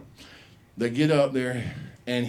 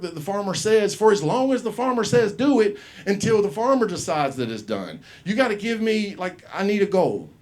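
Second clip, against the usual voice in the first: indignant outburst; 8 semitones higher than usual. Retold or imitated. Boy protesting to the school officials, high and indignant. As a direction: argue back at the grown-ups, outraged. Kid arguing angrily with school.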